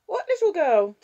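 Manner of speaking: put-on high, loud little-girl voice; curious child. Six-year-old breaking in on her grandparents, curious child voice. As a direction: pipe up loudly as a small child. Young child suddenly in the room.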